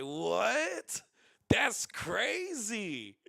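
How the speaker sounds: slow, drawn-out stunned "That's crazy". Retold or imitated. He reacts to her being ten minutes away, stunned. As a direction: stretch it out in disbelief.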